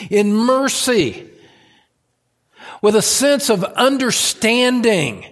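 impassioned preaching. Real, not acted. Preaches at full force.

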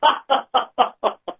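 a sudden high laugh. Real, not acted. Laughs at Roger's question.

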